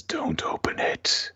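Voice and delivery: slow eerie whisper; slow ghostly whisper through a door; mysterious whisper. Retold or imitated. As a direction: breathe it like a voice behind a door. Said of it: "a voice ... that says".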